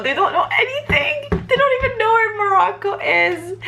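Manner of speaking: very high, laughing squeal; laughing disbelief. Real, not acted. Answering whether Japanese people know Morocco, high laughing disbelief. Breaking bad news with laughing exasperation.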